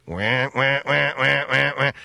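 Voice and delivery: repeated wah cries; mock animal wailing; imitated animal wah. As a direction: copy the creature's call. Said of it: "an animal going".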